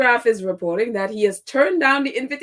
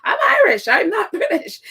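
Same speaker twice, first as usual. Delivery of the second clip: much higher, louder, laughing proud declaration; mock Irish mother; 8 semitones higher, 5 dB louder than usual. His mother telling him to avoid the British, mock proud declaration. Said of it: "She's like".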